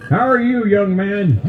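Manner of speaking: put-on high voice of an elderly Walmart shopper; old shopper. Old shopper greeting the greeter, in a high put-on voice. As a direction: croon it like a sweet old lady. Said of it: "looks at the greeter and goes".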